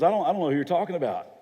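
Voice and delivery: quick, stammered brush-off; hurried denial. Acted. Peter to his first accuser, hurried denial. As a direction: deny it fast, tripping on the first words.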